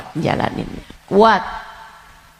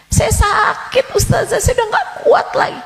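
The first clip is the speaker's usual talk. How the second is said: pitched-up lament with a laugh in it; high wailing complaint; 13 semitones higher, faster than usual. Acted. A sick congregant to the ustazah, high and wailing. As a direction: wail it high.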